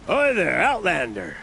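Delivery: loud, high greeting falling on the last word; hailing a stranger. Acted. Recruiter spots a passing Outlander, hailing loudly. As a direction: hail a passer-by eagerly.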